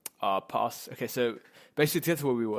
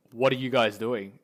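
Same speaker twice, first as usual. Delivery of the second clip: louder, lightly acted angry teacher; 7 dB louder than usual. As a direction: imitate a cross teacher confronting kids. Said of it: "she looks pissed as ever".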